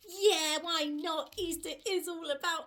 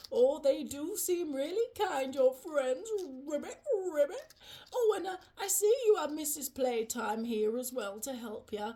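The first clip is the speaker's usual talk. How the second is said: low, genial frog character; friendly frog; 7 semitones lower than usual. Acted. Froggy admiring Rabbit's kind friends at home, friendly frog. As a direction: play an approving low-voiced frog.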